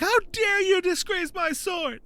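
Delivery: high, drawn-out outraged cry; mock freaking out. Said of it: "started freaking out".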